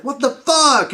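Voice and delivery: high, loud disbelieving cry; shocked outburst. Retold or imitated. Fan seeing Han stabbed, loud shocked cry. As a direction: shout it out in shock. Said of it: "I was like".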